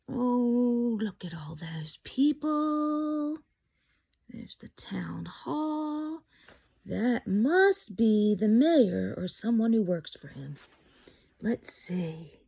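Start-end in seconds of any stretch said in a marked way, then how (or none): 0.1-6.2 hushed whisper, slow and amazed. Real, not acted. Whispered aside while reading. 11.4-12.4 soft hushed whisper. Real, not acted. Whispered aside.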